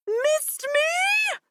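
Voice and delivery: high piercing shriek. Acted. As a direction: shriek it at them. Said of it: "she shrieks".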